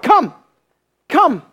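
short high calls, like calling a dog; mild dog-calling come. Shown as a demonstration. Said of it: "you're calling a dog".

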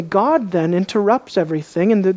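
ordinary talk, nothing marked

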